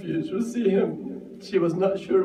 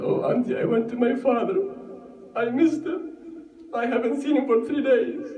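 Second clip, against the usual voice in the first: mild sobbing nephew; 6 semitones higher, faster than usual. Acted. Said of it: "He started sobbing and said".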